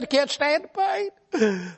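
high, tearful whine about pain; whimpering hypochondriac. Retold or imitated. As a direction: whine like every touch is agony. Arzy moaning slowly.

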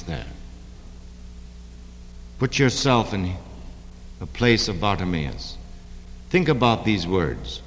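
hushed pastor guiding meditation; prayer leading. Real, not acted. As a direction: ease the room into quiet prayer.

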